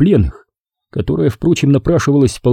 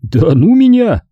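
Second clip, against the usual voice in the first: light cheerful agreement; 8 semitones higher, 4 dB louder, slower than usual. A narrator's reading. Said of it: "легко согласился Малахов".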